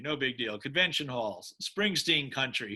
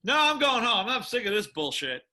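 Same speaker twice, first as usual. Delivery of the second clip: mock fed-up quitter; 8 semitones higher than usual. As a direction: imitate the guy who storms off early. Mimicking a bridge-burner leaving early.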